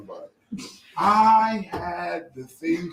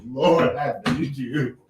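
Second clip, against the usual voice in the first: low, slow plea; desperate prayer; 8 semitones lower, slower than usual. Retold or imitated. In church needing the bathroom, slow plea. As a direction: pray it in desperation. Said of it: "I said".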